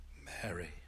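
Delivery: Mary said very soft and low; tender. Acted. Jesus tenderly calling Mary by name, very soft. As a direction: breathe her name gently. Jesus gently names her.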